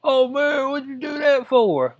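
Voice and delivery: high, loud whiny "oh man" of someone just cut; mock shocked victim. Ranting vlogger playing a stunned stab victim, high and loud. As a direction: play the stunned guy who just got sliced, high and loud. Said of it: "You'd be like".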